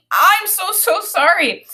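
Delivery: put-on a kid pleading sorry; apologetic boy. A narrator's reading. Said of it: "Daniel was saying".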